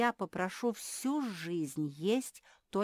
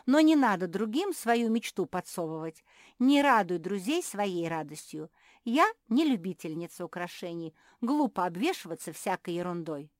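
loud, biting rebuke; scornful; 3 semitones higher, 9 dB louder than usual. A narrator's reading. Sister refusing another's dream, scornful. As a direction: throw the advice back with disdain. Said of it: "язвительно засмеялась Жози".

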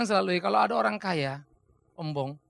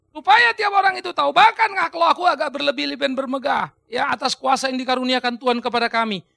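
louder, proclaiming boldly; preaching Paul's words; 6 dB louder than usual. Acted. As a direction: declare it like the apostle. (berkhotbah lantang menyuarakan Paulus)

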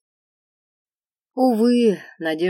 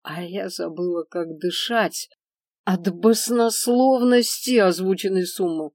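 ordinary delivery, nothing marked

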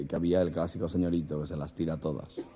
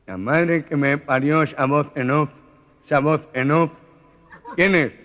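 high, loud, slow alarmed shout; the nasal man shouts at the unseen voice; startled nasal man; 7 semitones higher, 9 dB louder than usual. Acted. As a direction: yell it in a panic.

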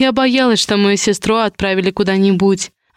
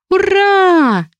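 put-on child's cheer; 11 semitones higher, 3 dB louder than usual.

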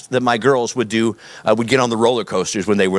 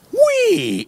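very high, playful acted whee; gleeful squeal. Preacher on living with God as a thrill ride, mock gleeful squeal. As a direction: squeal way up high, like riding a coaster.

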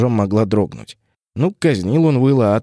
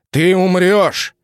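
high, slow roaring threat; 7 semitones higher, 3 dB louder, much slower than usual. Acted. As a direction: roar the death threat. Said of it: "проревел он".

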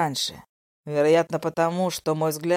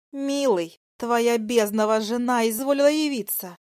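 venomous dragoness, raised pitch; drake; 7 semitones higher than usual. Acted. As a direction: spit it out with mock sweetness. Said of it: "со злостью прошипела драконица".

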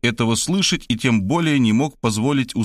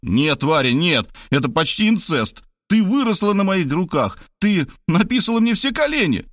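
frantic protest cry; 8 semitones higher than usual. A narrator's reading. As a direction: voice climbing higher as he protests. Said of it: "и кричал". (отчаянный протест, голос всё выше)

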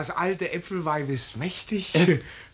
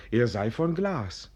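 usual speaking voice

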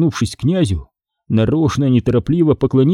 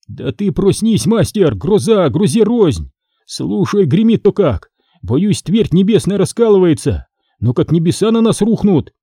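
higher-pitched, lightly acted alarm; Никишка, напуганный грозой; 7 semitones higher than usual. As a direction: urge the master awake, frightened by thunder. (встревоженный подмастерье, голос повыше)

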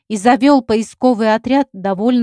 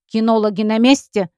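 ordinary delivery

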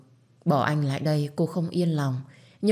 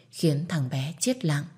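ordinary delivery, nothing marked

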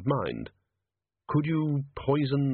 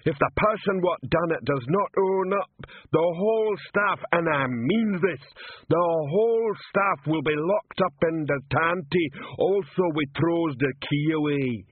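slow, much higher bellowing troll; troll shouting; 6 semitones higher than usual. Acted. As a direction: shout it at the lined-up staff. Troll interrogating staff.

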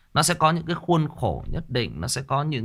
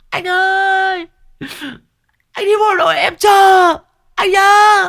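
put-on shrill falsetto wail of a girl begging, much higher and louder; mock girlfriend; 9 dB louder, slower than usual. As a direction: ham up a weepy girlfriend crying out. Mocking crying girlfriend at send-off.

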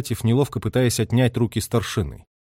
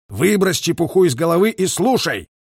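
raised-pitch, firm rebuke; a commander's tough command; harsh order; 6 semitones higher, 4 dB louder than usual. A narrator's reading. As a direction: order him sharply to listen. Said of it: "жёстко перебил Гуляев".

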